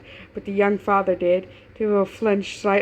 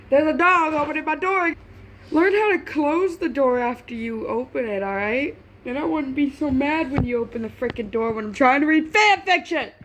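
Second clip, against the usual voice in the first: annoyed scolding; 6 semitones higher, 3 dB louder than usual. Real, not acted. Scolding dog opening door.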